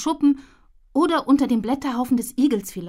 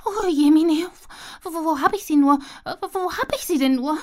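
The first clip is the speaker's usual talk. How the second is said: high, fretting squirrel voice; flustered little squirrel searching; fretful squirrel; 6 semitones higher, 3 dB louder than usual. Acted. Squirrel frets while hunting her buried nuts.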